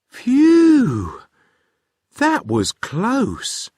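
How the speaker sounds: loud high phew, then slow; girl relieved after a near crash; big relief. Acted.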